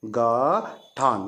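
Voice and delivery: very slow गठान, split as ग and ठान; drawn-out syllables. Shown as a demonstration. Teacher giving pupils the Hindi for knot, slowly drawn out.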